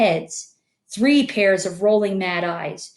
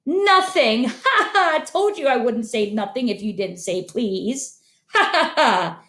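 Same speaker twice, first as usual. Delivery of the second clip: high taunting cackle; gleeful cackle; 3 semitones higher than usual. Acted. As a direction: crow in delight at the trick. Peeves triumphantly mocks Filch.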